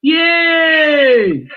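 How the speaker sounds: loud happy yay; excited cheer. Real, not acted. Friend cheering the party question, excited shout. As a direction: cheer for the fun part.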